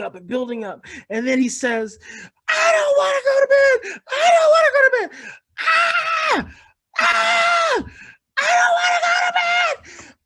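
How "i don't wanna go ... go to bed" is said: high, loud crying tantrum shout; screaming upset child. Retold or imitated. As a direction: wail it like a child who refuses bed. Child screaming about bedtime.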